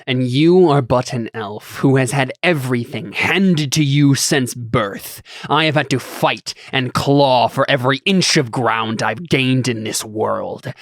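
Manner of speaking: grand, slow villain speech; lofty. Acted. A self-made lord rebuking a privileged elf, lofty and dramatic. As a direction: declaim it slowly and proudly, leaning on key words. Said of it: "we sound so lofty".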